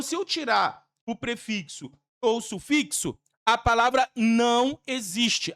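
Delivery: ordinary talk, nothing marked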